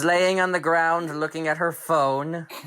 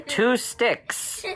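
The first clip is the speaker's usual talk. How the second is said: usual speaking voice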